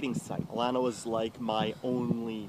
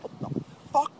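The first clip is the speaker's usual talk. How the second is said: outraged whisper-shout; 4 dB louder than usual. Retold or imitated. Thrown out by bouncers.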